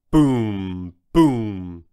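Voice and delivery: deep, drawn-out, resonant booms; booming footsteps. A narrator's reading. Storyteller sounds out a giant dinosaur's footsteps, booming. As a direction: boom like giant feet landing.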